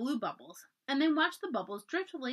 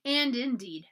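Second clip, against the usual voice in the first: usual speaking voice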